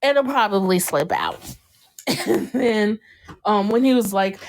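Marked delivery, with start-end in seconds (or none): none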